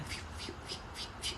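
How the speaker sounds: unvoiced soft blowing puffs; flame whoosh. Reader mimicking her candle flaring for Tiana, soft unvoiced puffs. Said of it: "my candle just started going".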